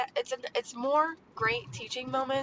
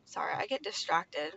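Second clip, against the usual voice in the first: normal talking voice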